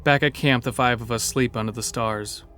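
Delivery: ordinary delivery, nothing marked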